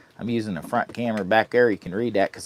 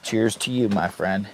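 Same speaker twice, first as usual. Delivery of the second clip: ordinary delivery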